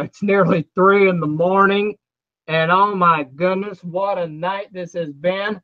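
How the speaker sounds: ordinary talk, nothing marked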